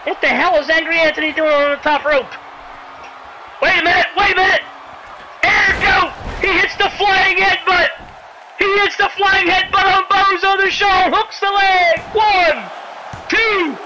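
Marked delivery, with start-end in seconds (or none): 3.6-13.8 high, shouted climax call; excited play-by-play. Real, not acted. Commentator shouting a flying headbutt and pin, high excited call. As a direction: call the big move and count urgently.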